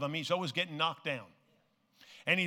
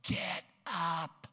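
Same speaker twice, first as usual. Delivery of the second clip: mild mock yell; 6 dB quieter, much slower than usual. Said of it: "yells at him".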